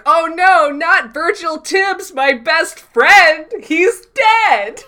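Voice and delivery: loud, high, drawn-out hammy wail; fake-acted anguish. Sam pretends his best friend died, hammed-up anguish. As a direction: overact grief at top volume, stretching the words. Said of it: "The fake acting in this episode is incredible".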